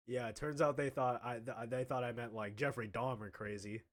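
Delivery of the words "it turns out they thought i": usual speaking voice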